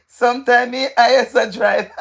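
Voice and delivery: real laughter creeping in; giggling through the words; breaking into laughter. Real, not acted. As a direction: read it out and crack up. Cracks up reading viewer's joke.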